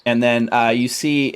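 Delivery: ordinary delivery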